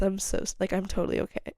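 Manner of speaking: ordinary talk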